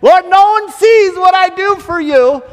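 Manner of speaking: high, loud self-pitying whine; whining complaint to God. Retold or imitated. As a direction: wail it like a hurt martyr. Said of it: "like Elijah in the cave".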